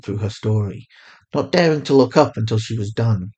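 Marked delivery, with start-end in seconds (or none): none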